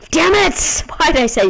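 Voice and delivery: high, loud frustrated yell. Real, not acted. Gamer yelling after dying in the level. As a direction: yell it as the character dies.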